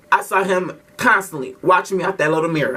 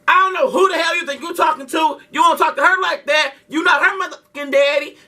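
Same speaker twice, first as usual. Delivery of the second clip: furious shout; 8 semitones higher than usual. Retold or imitated. Defending his sister by yelling at the bus driver. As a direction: tell the driver off hard. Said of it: "I goes off on him".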